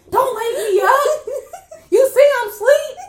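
very high, loud indignant grandma voice; imitated cranky grandma. Grandma to grandchild who woke her, mock indignant. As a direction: scold like a woken-up grandma, hammed-up. Grandma annoyed at being woken.

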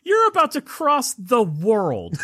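high, laughing questions at the rats; laughing mock outrage. Real, not acted. Incredulous mock scolding of characters.

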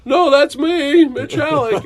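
host introducing himself to his idol, high and drawn out; eager introduction. Retold or imitated. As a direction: announce yourself with a beaming stretch. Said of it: "I'm like".

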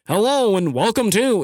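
a hint of formal announcer. Shown as a demonstration. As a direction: announce it like a show intro. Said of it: "like, an announcer voice for this podcast".

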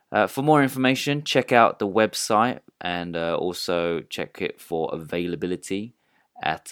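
measured podcast advert read. Acted. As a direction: read out the lodge's selling points clearly. Reads a lodge advert.

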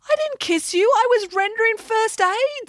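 high, indignant protest; flustered Neville. Acted. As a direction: squeak out a defence.

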